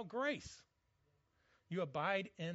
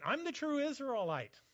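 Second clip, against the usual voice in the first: excited; 9 semitones higher, 6 dB louder than usual. Retold or imitated. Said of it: "he's saying".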